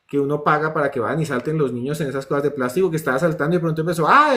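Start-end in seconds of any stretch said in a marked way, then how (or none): 4.1-4.4 un ay agudo y fuerte, imitado; grito agudo de niño. Retold or imitated. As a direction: grita de golpe como un niño. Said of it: "a gritar". (grito agudo imitado)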